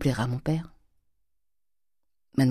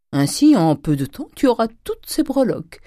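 gleeful; 7 semitones higher, 5 dB louder than usual. Acted. Grandet gloating, rubbing his hands.

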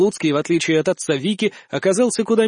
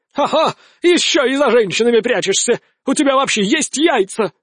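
angry; 5 semitones higher than usual. Acted. Enraged patriarch keeps shouting.

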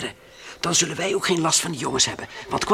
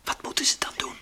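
whisper; 3 dB quieter than usual. Acted. Hidden, watching natives.

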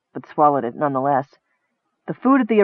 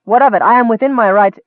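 raised, higher indignant voice; indignant outburst; 7 semitones higher, 6 dB louder, faster than usual. Acted. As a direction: snap back in defiance, voice raised high. Errol defends himself heatedly.

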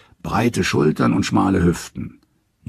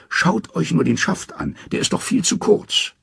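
much higher, nervous trickster voice; Loki, scared; 11 semitones higher than usual. Acted. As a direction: pitch up into a wary, scheming Loki.

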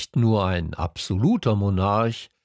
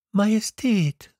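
little prince; 11 semitones higher than usual. A narrator's reading. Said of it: "sagte er".

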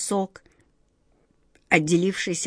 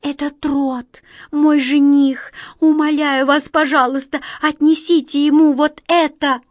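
pleading; 6 semitones higher, 5 dB louder than usual. A narrator's reading. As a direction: beg her for your fiancé. Girl begs the mouse queen.

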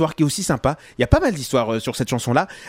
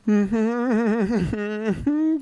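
long, wavering "hmmm"; humming while making up your mind; hesitating hum; 4 semitones higher than usual. Real, not acted. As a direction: drag out an undecided hum. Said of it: "j'hésite".

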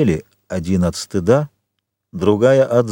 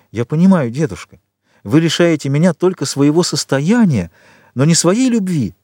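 heartfelt exclamation, higher pitched; exclaiming; 7 semitones higher than usual. A narrator's reading. Valentine to her grandfather who disinherits her, exclaiming. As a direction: exclaim it with rising emotion, higher. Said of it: "что она воскликнула".

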